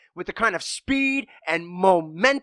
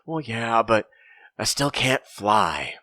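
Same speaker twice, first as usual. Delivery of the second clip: low, droopy, slow voice; dejected rhino; 9 semitones lower, slower than usual. A narrator's reading. Sad rhino telling his friends he still can't fly, low and slow. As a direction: let it sink, defeated.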